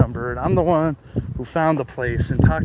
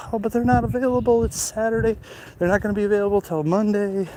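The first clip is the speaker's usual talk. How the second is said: lightly voiced date, pitched higher; imitating date; 8 semitones higher, faster than usual. Date explains the super is away, imitated higher. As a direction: quote her objection in a higher, quicker voice. Quoting his worried date.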